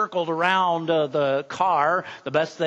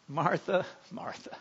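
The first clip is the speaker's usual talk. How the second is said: soft, tender, almost fading to silence; gentle; 9 dB quieter than usual. Retold or imitated. Jesus gently correcting busy Martha, soft and tender. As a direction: call her name lovingly and softly. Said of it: "gently says".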